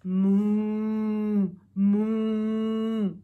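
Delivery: long steady moos; drawn-out moo; cow moo. Acted. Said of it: "the duck on the pond said".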